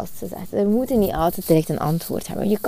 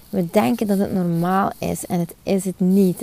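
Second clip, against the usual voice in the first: usual speaking voice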